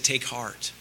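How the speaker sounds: Jesus gently calming his terrified disciples; tender reassurance. Acted. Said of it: "He said".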